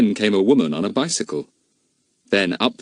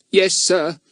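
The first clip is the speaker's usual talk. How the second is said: put-on meek boy; 11 semitones higher than usual. A narrator's reading. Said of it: "said the boy meekly".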